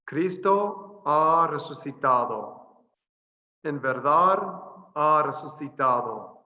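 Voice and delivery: full greeting and response at a slow, even teaching pace; careful Spanish. Shown as a demonstration. Teacher says the whole Easter greeting on request, slow and clear. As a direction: say "Cristo ha resucitado, en verdad ha resucitado" slowly with a tapped r. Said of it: "say it again, the whole thing for us".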